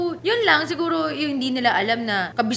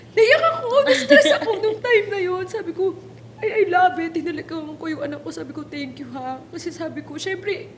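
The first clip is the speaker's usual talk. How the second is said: tearful, voice breaking while laughing; choked up, high and tearful; after crying; 5 semitones higher, 3 dB louder than usual. Real, not acted. (naiiyak habang nagkukuwento)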